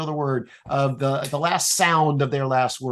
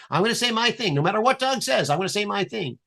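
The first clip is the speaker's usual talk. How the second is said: higher, stubborn self-centred mutter-free declaration; mock stubborn talker, pitched up; self-absorbed inner voice; 6 semitones higher than usual. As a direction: play someone set on their own line no matter what. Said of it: "preparing to say my thing".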